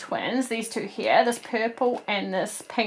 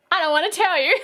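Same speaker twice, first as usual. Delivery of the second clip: coy tease; 8 semitones higher, faster than usual. Retold or imitated. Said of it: "And I was like".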